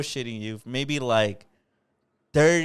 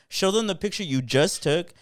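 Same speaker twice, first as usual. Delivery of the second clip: mild mock yell, raised pitch; retold yell; 6 semitones higher than usual. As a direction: call it out challengingly, only half yelling. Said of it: "I literally yelled out loud".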